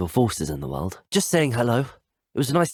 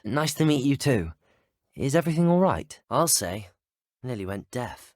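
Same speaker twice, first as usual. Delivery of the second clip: ordinary talk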